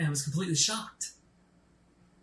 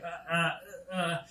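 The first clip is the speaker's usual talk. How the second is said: softer, stalled stutter like a glitching robot; malfunctioning robot; 4 dB quieter, much slower than usual. Retold or imitated. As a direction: freeze up mid-word like a broken machine. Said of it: "a malfunctioning robot".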